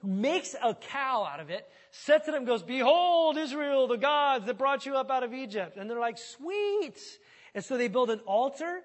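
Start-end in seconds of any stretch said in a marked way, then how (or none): 2.6-5.8 loud, high, showy announcement; grand proclamation. Retold or imitated. Aaron unveils the calf to Israel, loud and grand. 6.2-7.0 much higher, dopey cheer; mock delighted. The crowd cheers the calf in a high mock voice. People react to the idol.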